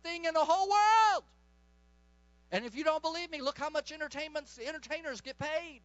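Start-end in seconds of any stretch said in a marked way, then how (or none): 0.0-1.2 building to a loud, high shout; indignant shout. Retold or imitated. Preacher decrying the devil's big moment to congregation, building to a shout. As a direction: climb to an outraged preaching shout.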